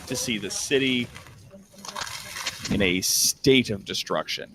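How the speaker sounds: low, measured narration; unhurried, low-pitched description of a ruined city; game master's scene setting. Acted. Game master shows players the burning city, scene-setting narration.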